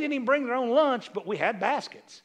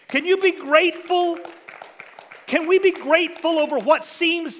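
louder, higher, rousing preacher voice; preaching at full force; 4 semitones higher, 5 dB louder than usual. Acted. Preacher urging his church to give thanks, at full force. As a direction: drive it home to the congregation.

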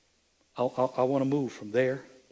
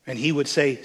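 loud, raised-pitch scripture proclamation; forceful preaching; 4 semitones higher, 7 dB louder than usual. Acted. Preacher telling of Gileadites and Ephraimites, forceful preaching. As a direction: declaim it to the whole congregation.

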